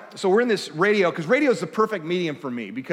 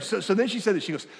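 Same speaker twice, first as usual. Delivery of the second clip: ordinary talk, nothing marked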